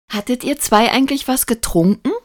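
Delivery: put-on high, needling child's question; mischievously malicious. A narrator's reading. Lukas questions the two grown-ups, mischievously malicious. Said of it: "fragte er boshaft".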